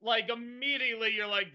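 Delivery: ordinary delivery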